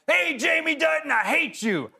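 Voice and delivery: high-pitched imitated heckler's yell. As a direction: holler it like a stranger across a store. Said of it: "some man will yell out".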